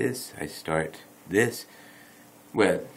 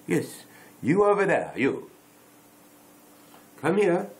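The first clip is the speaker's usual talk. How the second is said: high, loud call to someone over there; mock summons, raised voice; playful calling out; 9 semitones higher, 5 dB louder than usual. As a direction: beckon a stranger over, pitched up and loud. Beckoning an imagined viewer over.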